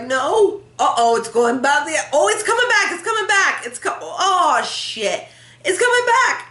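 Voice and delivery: high-pitched mock panic, rising to a cry; acted excited viewer. Viewer watching the Plinko chip, hammed-up excitement. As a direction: play a worked-up game show watcher.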